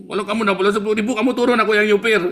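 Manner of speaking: pushy passenger snapping at the driver; rude passenger. Acted. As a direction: bark it at the driver like you own the bus.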